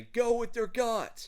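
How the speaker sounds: high, loud rallying cry; cheering encouragement. Acted. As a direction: urge him on like a cheerleader. Inner feelings urging him on.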